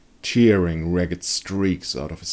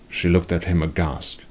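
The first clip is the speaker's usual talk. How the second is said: ordinary delivery, nothing marked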